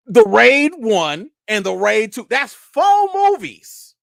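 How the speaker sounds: loud, slow, drawn-out emphasis; mock scolding. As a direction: count them off in hammed-up outrage. Mock scolding, counting off the movies.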